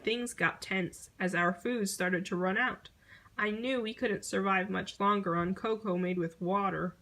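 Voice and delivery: ordinary delivery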